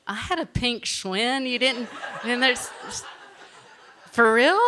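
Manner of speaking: high, laughing, then a loud "for real?"; laughing disbelief. Real, not acted. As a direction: laugh in shock at the good news. Told she qualified for Worlds.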